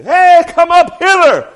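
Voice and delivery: very high, very loud, slow cry of God; God's booming call. Acted. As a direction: call them up to heaven with a huge voice. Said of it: "God Almighty is gonna say".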